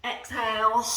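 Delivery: loud growl from the throat; growling exhale. Shown as a demonstration. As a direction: roar the breath out with a growl. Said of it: "get that growl in your throat".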